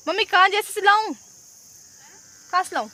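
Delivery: high, loud call to mother across the field. Real, not acted. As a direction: shout the question to mother far away. (दूर खड़ी mummy को ऊँची आवाज़ में पुकारना)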